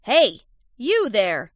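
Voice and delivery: loud, slow angry call; annoyed shout. A narrator's reading. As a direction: shout crossly at someone behind you.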